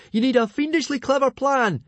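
high, loud frantic yell; wild shout. A narrator's reading. Fishlegs calling from below to trapped Hiccup, wild shouting. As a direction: yell advice up in a frenzy. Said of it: "Fishlegs shouted out wildly".

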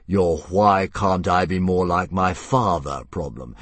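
a lazy monster toying with prey; dragon voice. Acted.

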